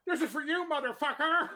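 high, loud yell through laughter; laughing mock yell. Retelling the nail gun scene, laughing yell. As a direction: shout the movie line while cracking up. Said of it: "when Nick Cage took the nail gun".

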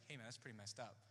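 low, quiet objection; retold attempt to be serious, voice dropped. To the older friend who laughed, quietly serious. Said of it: "trying to make it serious".

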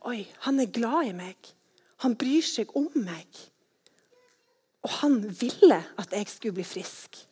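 hushed, awed whisper; tender whisper of being loved. Acted. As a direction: breathe it softly, like a secret. Whispering the woman's realisation.

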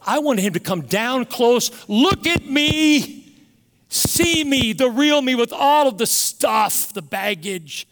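loud, high-pitched cry from the pulpit, words stretched; impassioned plea. Real, not acted. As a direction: build to a pleading shout, easing on the last words. Preacher's impassioned plea to God.

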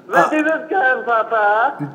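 high, slow mock German accent, laughing. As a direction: play a German child asking Papa. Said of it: "the German accent is fucking primo".

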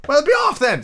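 high, loud, huffy dismissal; sulky pigeon. Acted. Pigeon dismissing Alice, sulky and high. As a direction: shoo her off in a high squawk. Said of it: "said the pigeon in a sulky tone".

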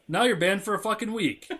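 re-enacted indignant parental sentence, pitched high; retold angry dad. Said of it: "I was pissed".

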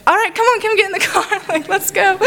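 overjoyed, giggling invite into the car; thrilled excitement. Retold or imitated. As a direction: squeal it out as joyful shock. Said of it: "I was like".

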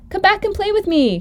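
high-pitched pleading call; high storybook call, not shouted; calling after. Acted. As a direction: call them back to play. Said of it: "called the little rabbit".

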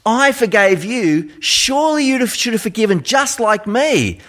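high, earnest royal rebuke; a forgiving king scolding his servant; disappointed king. Retold or imitated. King reproaching the unforgiving servant, high and earnest. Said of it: "the king says to him".

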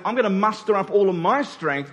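normal talking voice

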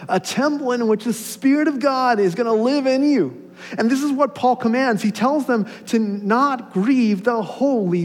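loud, high-pitched preaching at full force; excited preaching. Real, not acted. Pastor telling congregation of Paul's message, excited preaching. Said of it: "I'll start yelling".

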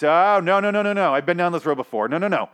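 loud, high rapid-fire protest; alarmed refusal. Acted. Fan catching himself getting hopeful, alarmed refusal. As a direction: cut yourself off in mock alarm.

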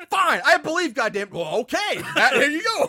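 loud, exasperated sarcastic concession; high, loud and fed up. Retold or imitated. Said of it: "Just sarcastically say".

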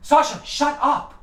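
high-pitched shout from another room; yelling at the dog. Real, not acted. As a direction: yell at the dog off-mic. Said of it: "I yelled at her".

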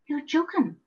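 high, incredulous "you're joking"; disbelief. A narrator's reading. Visitor hears of patients sharing socks, in mild disbelief. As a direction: say it as if you can't believe it. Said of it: "shaking my head in disbelief".

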